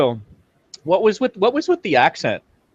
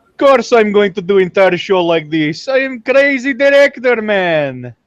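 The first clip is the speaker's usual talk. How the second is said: higher, louder Russian crazy-director voice; mock Russian accent; 4 semitones higher, 4 dB louder than usual. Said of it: "started throwing around accents".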